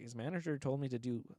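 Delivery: soft, subdued imitated aside; quiet retelling. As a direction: say it under the other man's rant, softly. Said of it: "quietly looked at me".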